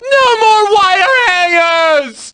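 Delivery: slow, wailing mock-tearful shriek; hysterical shriek. Fighter hurt in battle, shrieking hammed-up and tearful. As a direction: scream it like a melodramatic movie mother.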